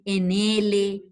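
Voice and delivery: ordinary talk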